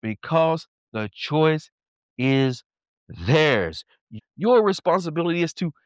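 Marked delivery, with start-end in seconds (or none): 0.0-3.8 very slow answer, sinking deep at the end; slow, grave divine reply; God. Retold or imitated. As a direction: answer word by word with long pauses, dropping low. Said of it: "He says".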